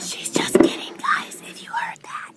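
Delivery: forceful whisper to viewers; confiding stage whisper. Real, not acted. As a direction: whisper a quick disclaimer to the camera. Aside to viewers during class.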